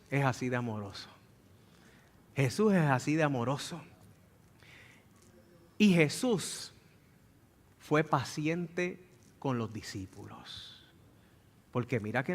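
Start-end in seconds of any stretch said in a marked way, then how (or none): none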